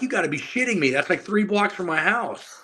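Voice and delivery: put-on young lifter learning the top program is next door, incredulous. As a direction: sound blown away and amused. Retold shock at news.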